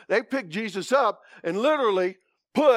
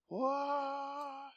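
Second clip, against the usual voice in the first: mock surprise; 7 semitones higher, 13 dB quieter than usual. Real, not acted.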